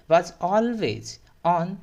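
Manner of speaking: very slow, every word laid out clearly; slow model reading. Shown as a demonstration. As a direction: read it out slowly for students to copy. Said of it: "मैं आपके सामने यहाँ पे pronunciate करके दिखा दूंगा". (धीमी, साफ़ पढ़ाई)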